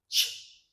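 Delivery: an isolated, voiceless "ch"; "ch" alone as a phonics model; CH sound demo. Shown as a demonstration. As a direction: give just the crisp "ch" sound, no vowel. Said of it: "The first one is".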